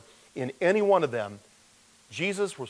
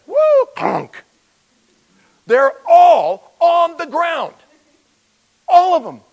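very high, loud cartoon yelp; goofy falling voice; 14 semitones higher, 14 dB louder, slower than usual. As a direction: squeal it like a cartoon character knocked flat. Soldiers knocked down, comic voice.

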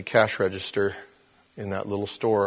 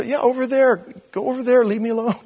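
much higher, louder, impatient dismissal; irritated brush-off; 12 semitones higher, 5 dB louder than usual. Retold or imitated. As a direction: shoo him away, fed up. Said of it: "he irritated me immediately".

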